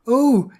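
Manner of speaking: sharp high yelp of pain; cry of pain. A narrator's reading. Bear stuck in the doorway, high pained yelp. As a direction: yelp as the door pinches. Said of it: "he cried in pain".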